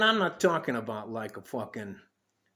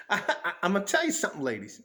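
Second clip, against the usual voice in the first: usual speaking voice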